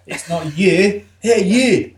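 a hint of Scottish accent imitated; mock Scottish accent. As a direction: tease the Scottish vowel. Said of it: "she'd say stuff in a Scottish way".

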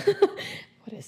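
slow, high, laughing disbelief. Retold or imitated. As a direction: ask it through laughter.

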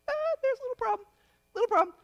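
a comic high squeak, quick; mock squeaky aside. Preacher to congregation, a mock high squeaky aside. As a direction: jump way up high and fast for a mock little problem.

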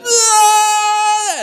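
loud, very high drawn-out bawl; hammed-up crying howl; mock wail. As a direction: burst out in exaggerated sobbing. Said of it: "he goes to his room and he cries".

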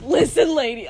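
demanding. Pretend. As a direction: confront the clerk loudly. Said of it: "I'm gonna be like".

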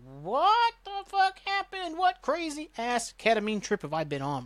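high, slow dazed squeal; stunned disbelief. Retold or imitated. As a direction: wake up baffled and squeak it out. Saeed waking up confused.

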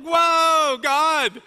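high, loud cry of awe; a big stunned whoa at God; awed exclamation. Retold or imitated. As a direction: shout it like you've just seen God. Retold awe at God's glory.

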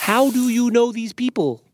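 high, projected repeated question; calling through a door. A narrator's reading. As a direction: raise it so they hear through the door. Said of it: "put his head right up to the door".

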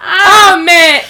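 loud laughing oh man; cracking up loudly; laughing exclamation. Real, not acted. Laughing at friend's crying story.

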